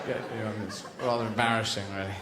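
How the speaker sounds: a lightly voiced Pavlov, grumbling; Professor Pavlov. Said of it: "Professor Pavlov".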